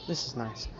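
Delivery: very soft, low murmur; soft contentment. Acted. She's laying on his arm, softly contented. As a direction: settle in with a quiet, low voice.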